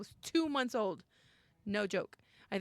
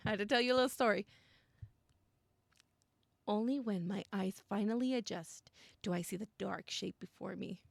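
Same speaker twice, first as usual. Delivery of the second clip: ordinary talk, nothing marked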